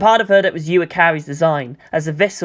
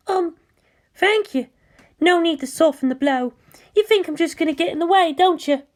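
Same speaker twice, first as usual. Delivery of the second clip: put-on higher, shy and eager-to-please girl; timid pilot Mayumi; 12 semitones higher than usual. Mayumi answering Asuka over the comm, higher shy voice. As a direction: voice Mayumi high and hesitant, like a nervous junior.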